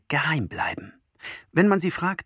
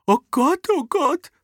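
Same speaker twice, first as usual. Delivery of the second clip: high moaning oh Gott, oh Gott; groaning; 5 dB louder than usual. A narrator's reading. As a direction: groan it in dismay. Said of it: "stöhnt Oma Rosella". (stöhnend)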